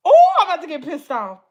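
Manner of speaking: boiling over at the viewers; getting angry. Real, not acted.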